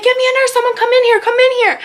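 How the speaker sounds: very high, urgent cry for help; high-pitched, panicky retelling; imitated freak-out. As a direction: act out frantic calling for a nurse. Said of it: "starts freaking out".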